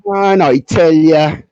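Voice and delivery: loud, drawn-out Bajan accent. Shown as a demonstration. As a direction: stretch it out in a big Bajan accent. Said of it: "Let's hear it, Pops".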